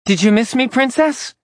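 high, mocking fake female voice; sing-song taunt in a borrowed voice; taunting in a woman's voice. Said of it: "taunted ... in a voice identical to Kat's".

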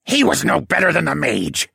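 high, loud, indignant villain; huffy. A narrator's reading. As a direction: huff it out dismissively. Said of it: "he said with a huff".

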